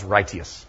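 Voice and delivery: righteous drawn into "RIGHT-ee-us"; Old English pronunciation. Shown as a demonstration. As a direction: give righteous three syllables, stressed on "right". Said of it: "Old English would have said".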